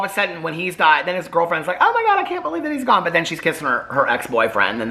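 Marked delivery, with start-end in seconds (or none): 1.8-3.0 high, fast, hammed-up wail of grief; mock grief. Mocking the girlfriend's overdone grief, high fast wail. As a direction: squeal it like a melodramatic widow. Girlfriend mourns dead boyfriend, mocked.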